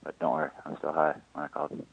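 dropping to a whisper at work; hushed. Real, not acted. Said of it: "sorry, I have to be quiet".

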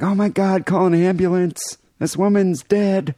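high-pitched mock panic; alarmed bystander. Bystander calling for help over an unconscious woman, high mock alarm. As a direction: act out a bystander raising the alarm.

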